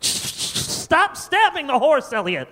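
high-pitched horrified cry; mock-horrified dad, pitch jumping up; alarmed parent. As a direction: plead in a panicky high voice. Parent yelling at murderous child.

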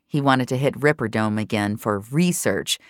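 normal talking voice

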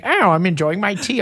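lightly put-on posh English; a hint of a tipsy British woman; mock British accent. Mocking the drunk friend sipping rum. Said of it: "she'd be like".

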